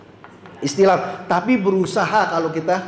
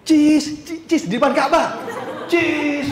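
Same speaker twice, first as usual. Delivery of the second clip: high-pitched, drawn-out photo cheese; mock selfie call; 8 semitones higher, slower than usual. Pilgrim posing for a photo before Ka'bah, high mock call. Acted selfie-taker during tawaf.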